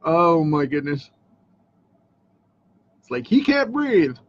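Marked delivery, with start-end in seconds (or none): 3.3-4.2 high, dramatic host announcement; game show host voice. Pretend. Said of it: "Get the game show host voice going".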